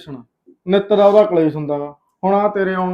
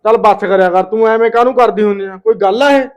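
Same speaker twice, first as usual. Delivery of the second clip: louder, heated scolding; angry shout; 3 semitones higher, 6 dB louder than usual. Acted. Husband shouts at wife over sister's visit. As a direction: shout her down in the quarrel.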